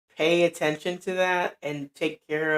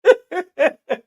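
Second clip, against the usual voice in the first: loud, high, disbelieving laugh; high burst of laughter; 13 semitones higher, 8 dB louder than usual. Real, not acted. Reaction to disliking her voice.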